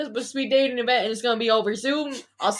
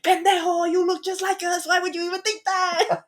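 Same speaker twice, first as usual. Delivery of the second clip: yelling mom; 7 semitones higher than usual. Retold or imitated. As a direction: scold like an exasperated mom. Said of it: "was yelling at him".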